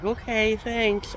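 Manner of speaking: high-pitched quoted reply; high, light mimicked thanks; imitating coworker. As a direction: lift the voice high to mimic her. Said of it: "she's like".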